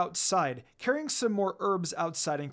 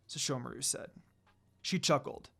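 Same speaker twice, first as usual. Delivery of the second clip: ordinary delivery, nothing marked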